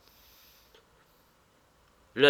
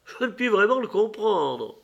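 high-pitched bafflement; high old lady's voice; exclaiming old lady; 10 semitones higher than usual. A narrator's reading. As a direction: exclaim as the puzzled old hostess. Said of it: "s'écria la vieille dame".

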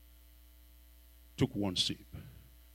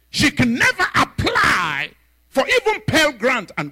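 boasting spirit; 13 semitones higher, 6 dB louder than usual. Retold or imitated.

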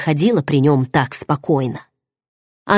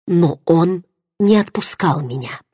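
ordinary delivery, nothing marked

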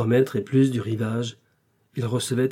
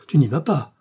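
raised, louder protest; anxious cry; 4 semitones higher, 5 dB louder than usual. A narrator's reading. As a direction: cry out to hold him back. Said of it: "cria Madeleine en se levant".

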